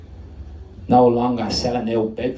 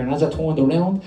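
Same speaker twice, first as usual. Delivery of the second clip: ordinary delivery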